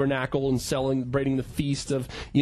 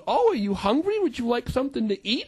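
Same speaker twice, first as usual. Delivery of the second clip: lightly voiced, higher doting grandma; a hint of a fussing grandmother; sweet grandma; 6 semitones higher than usual. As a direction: ask like a kindly old grandma.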